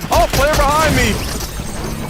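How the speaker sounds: high, drawn-out "oh" of alarm; startled cry. Real, not acted. Gamer spotting an enemy behind, startled. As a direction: yelp as you get caught off guard. Ambushed by a player in game.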